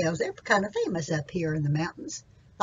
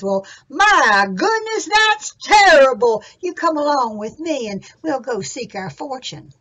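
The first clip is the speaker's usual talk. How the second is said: Jack's dismay; 4 semitones higher, 10 dB louder than usual. Acted. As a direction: exclaim in big storybook dismay. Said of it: "hands on his hips".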